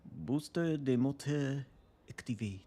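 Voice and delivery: bad French accent. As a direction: put on a terrible French accent for the ship's booster. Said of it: "that's terrible French accent".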